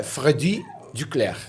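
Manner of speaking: Freddy Duclerc in French, stressed on final syllables; French pronunciation. Shown as a demonstration. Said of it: "a pronúncia correta é". ("Freddy Duclerc" com pronúncia francesa)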